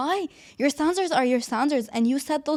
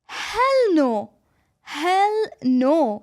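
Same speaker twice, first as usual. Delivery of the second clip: high loud hell no; emphatic hell no; 3 semitones higher, 4 dB louder, much slower than usual. Real, not acted. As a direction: reject it flat out, loudly. Emphatic refusal.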